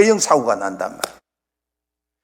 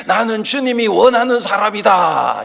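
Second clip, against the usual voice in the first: 크게 외침; 4 semitones higher than usual. Shown as a demonstration. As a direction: call it out as a proclamation. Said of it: "스스로 크게 한번 외쳐보십시다". (크게 외치는 선창)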